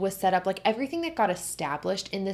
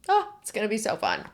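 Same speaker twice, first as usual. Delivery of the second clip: excited; 3 semitones higher, faster than usual. Real, not acted. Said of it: "I am so excited".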